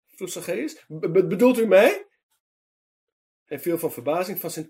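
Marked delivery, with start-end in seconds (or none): 0.9-2.1 high, loud stammer of disbelief; Dutch: verbaasd stotterend; stammering in surprise. A narrator's reading. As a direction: stumble over the words, stunned. Startled tax man stammers.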